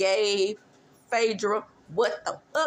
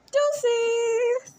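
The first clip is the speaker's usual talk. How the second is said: playful sign-off; 3 dB louder than usual. Real, not acted. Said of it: "signing off".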